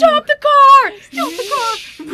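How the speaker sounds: high loud shout, then slow drawn-out repeat; dramatic yell. Pretend. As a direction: scream for the car to stop. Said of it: "This dramatic moment".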